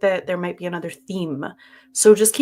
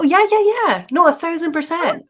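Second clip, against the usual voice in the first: high, loud, eager agreement; emphatic reassurance; 8 semitones higher, 6 dB louder than usual. Real, not acted. Co-host reassuring her about the story voice, emphatic. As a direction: insist loudly with pitch jumping up. Insisting she loves the story voice.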